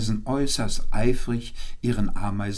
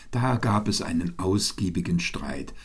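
plain reading voice

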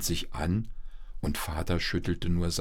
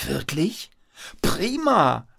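high, rising disbelief into a cheer; delighted child; 9 semitones higher, 4 dB louder, slower than usual. A narrator's reading. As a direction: burst out in boyish delight. Paul delighted to skip school. (begeistert jubelnder Junge)

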